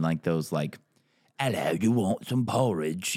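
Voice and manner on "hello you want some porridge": slow, exaggerated rough English accent; caricatured English accent, drawn out. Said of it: "there's a couple in England".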